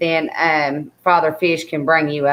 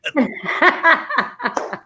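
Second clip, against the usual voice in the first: high laugh; 11 semitones higher than usual. Real, not acted. Joke about dirty old man.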